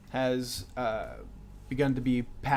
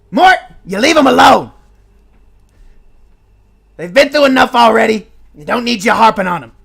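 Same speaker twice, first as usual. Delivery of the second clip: high, loud chiding voice; a gnome twin yelling at Mort to leave the heroes alone; scolding gnome brother; 9 semitones higher, 18 dB louder than usual. Acted. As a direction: tell your brother off to protect the heroes. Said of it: "another voice go".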